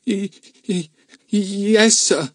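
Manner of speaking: nervously stuttering out a yes. A narrator's reading. Luke stutters his answer to the policeman, mildly scared. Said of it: "stuttered Luke".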